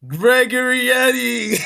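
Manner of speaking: high, loud, drawn-out name call; playful, stretched-out high yell; mock calling out.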